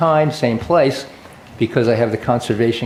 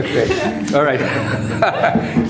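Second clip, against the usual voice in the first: laughing; 3 semitones higher, much slower than usual. Real, not acted. Chair still laughing.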